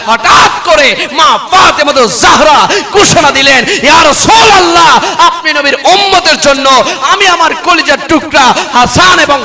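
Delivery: loud, high, stretched-out declamation; impassioned sermon shout; full-force preaching. Real, not acted. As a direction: proclaim the sacrifice to the whole crowd.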